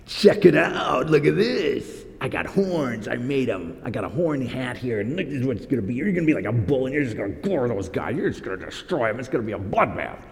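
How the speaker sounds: a hint of a showy prophet with his horn hat; Zedekiah's boast. False prophet bragging to Ahab, mildly hammed-up boast. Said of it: "he has an object lesson".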